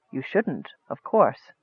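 low, hushed stranger's voice; wizard's reply. A narrator's reading. Sinister stranger to distrustful Michael, low and quiet. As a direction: answer softly and low, giving little away.

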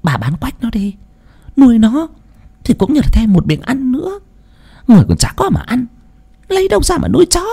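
put-on high, coaxing old neighbor woman voice; neighbor woman's coaxing whisper-talk. A narrator's reading. Neighbor urging Bà Hạnh to sell the dog, high coaxing voice. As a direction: lean in and coax her to sell the dog. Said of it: "bà Hương hàng xóm thủ thỉ rằng".

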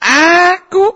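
high, furious mock shout; acted angry ruler. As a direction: roar it like an outraged ruler. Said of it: "Marah dia". (menirukan penguasa marah dengan teriakan tinggi)

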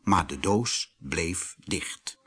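ordinary delivery

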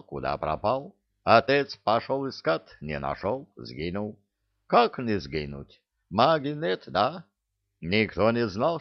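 put-on animated tale in a Caucasian accent; Givi holding forth. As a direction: pour out the saga as comic Givi. (Гиви увлечённо рассказывает с кавказским акцентом)

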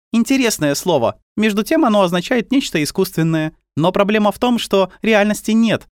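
put-on high, matter-of-fact girl voice; girl character. A narrator's reading. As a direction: voice a calm, knowing little girl.

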